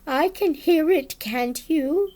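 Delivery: slightly anxious small creature; Piglet anxious. A narrator's reading. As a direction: ask with a touch of worry. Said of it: "said Piglet anxiously".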